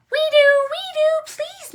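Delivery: very high, loud excited little squeal; eager animal chorus. Acted. Animals begging Mister Horse for a ride, high eager cries.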